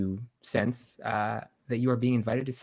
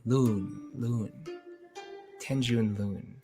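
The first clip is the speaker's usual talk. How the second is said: musing slowly; 8 dB quieter, slower than usual. Acted. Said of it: "turn your name over on his tongue".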